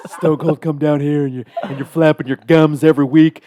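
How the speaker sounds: loud, laughing trash-talk promo; wrestling promo. Pretend. Wrestling promo as Stone Cold.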